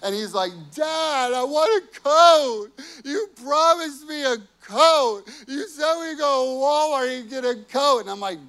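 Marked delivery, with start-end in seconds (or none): 0.7-8.0 put-on crying child's plea. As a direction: wail like a little boy who was promised a coat. Crying son demands promised coat.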